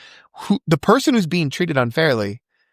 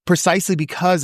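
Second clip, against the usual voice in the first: ordinary talk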